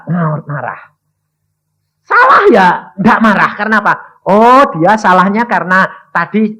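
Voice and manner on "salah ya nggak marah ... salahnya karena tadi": fiery preaching. Acted. Preacher crying out to the church about understanding. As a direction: preach it out to the congregation.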